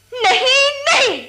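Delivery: loud, breaking cries of no; anguished refusal. Acted. Widow rejecting the killer's money, crying out. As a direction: refuse through tears, high and loud.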